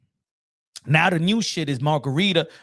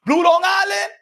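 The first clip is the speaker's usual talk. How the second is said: high, loud incredulous shout; surprised bartender shout; 13 semitones higher, 6 dB louder than usual. Retold or imitated. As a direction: blurt it out like you can't believe the order. Bartender surprised at his drink.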